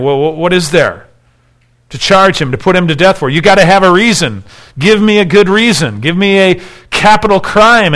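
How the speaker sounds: acted exasperated plea, pitch climbing as it goes; reasoning appeal. Pilate trying to reason with the mob, insistent appeal. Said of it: "Pilate's trying to reason with them".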